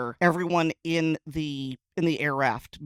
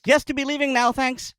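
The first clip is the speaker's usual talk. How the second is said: high, loud, brisk brush-off; impatient reply; 9 semitones higher, 6 dB louder than usual. Acted. Departing crew to chatty parking control, impatient.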